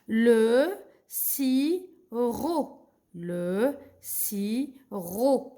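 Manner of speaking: very slow "sirop" as sii... ro; teacher sounding out with gaps, no P; silent P. Shown as a demonstration. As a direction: stretch every syllable of "Le sirop". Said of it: "Le P, c'est une lettre muette".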